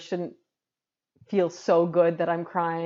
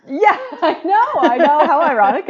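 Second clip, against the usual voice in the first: high laughing "yeah, I know", slowed; giggling through the words; 9 semitones higher, 12 dB louder than usual. Real, not acted. Bursts out laughing at irony.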